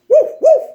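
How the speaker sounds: very high, loud yappy barking; puppy bark. Acted. The puppy barks at the pigeon, high and yappy.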